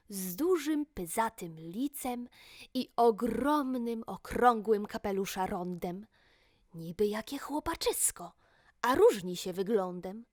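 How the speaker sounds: plain reading voice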